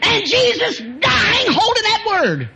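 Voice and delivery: very high, loud, slow cry; preacher at full force; preaching shout. Real, not acted. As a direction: wail it out slowly. Preaching climax.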